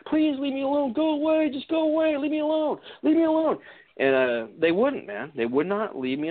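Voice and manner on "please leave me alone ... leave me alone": high, pleading voice begging them to go; pleading to be left alone. Retold or imitated. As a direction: beg the creatures to go away, voice pitched way up. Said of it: "I would be telling them".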